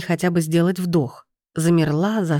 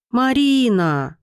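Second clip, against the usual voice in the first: slow squeaky plea, higher; писк; 6 semitones higher, much slower than usual. A narrator's reading. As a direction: squeak the name out slowly. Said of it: "пищала". (жалобно пищать)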